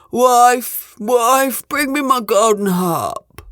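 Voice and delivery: loud ogre summons, drawn out at the end; ogre calling out. A narrator's reading. Ogre wanting his harp after breakfast, loud call. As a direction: holler for the wife. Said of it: "the ogre called out".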